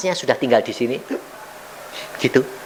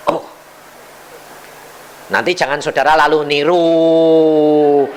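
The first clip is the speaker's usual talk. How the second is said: normal talking voice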